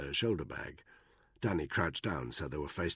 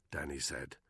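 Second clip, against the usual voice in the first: ordinary delivery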